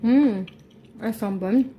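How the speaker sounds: talking with food in mouth; mouth full. Real, not acted. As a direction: mouth full, just tasted. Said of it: "je parle la bouche pleine".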